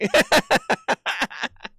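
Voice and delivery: high, helpless laughter; long cackle. Real, not acted. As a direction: burst out laughing before the story. Laughs about the weekend story.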